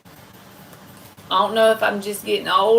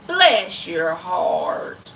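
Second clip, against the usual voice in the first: slow Southern granny bless your heart; imitating granny; 3 semitones lower, much slower than usual. Streamer quotes her granny, slow Southern drawl. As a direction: drawl it like a sweet old granny. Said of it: "what my granny will say".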